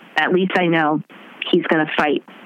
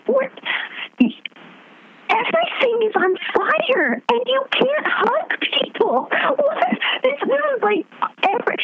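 exasperated shriek of disbelief; incredulous outburst. Retold or imitated. Incredulous outburst at voter's quote.

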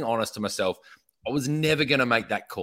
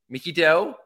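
normal talking voice

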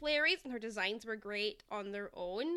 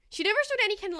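higher and louder emphasis; exasperated; 8 semitones higher, 5 dB louder, much faster than usual. Real, not acted. Complaining to a friend, exasperated. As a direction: push it out in frustration.

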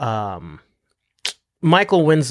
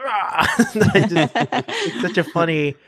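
talking through laughter; real giggling; 5 semitones higher, much slower than usual. Real, not acted. Guest amused by the lungs image, real giggling. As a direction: try to explain while cracking up, slowly. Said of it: "sorry, it's so funny".

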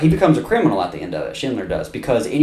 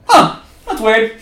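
dumb Nazi guard; 11 semitones higher, 6 dB louder, slower than usual. Retold or imitated. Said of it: "and the Nazis are like".

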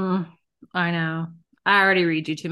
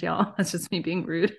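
ordinary talk

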